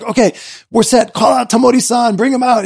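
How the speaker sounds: high, urgent crew orders; calling out orders. Retold or imitated. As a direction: rush the star out.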